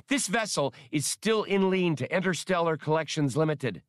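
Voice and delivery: a shrill-pitched anxious bleat; squawked. Acted. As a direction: squawk the warning at the pilot. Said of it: "squawked the skip tracer".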